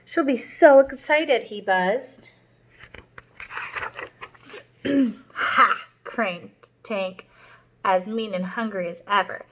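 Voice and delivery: put-on lively read-aloud for young kids; storybook read-aloud. A narrator's reading. Teacher reading a story to her class, lively storytelling. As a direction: read it out like a children's picture book. Reads picture book to class.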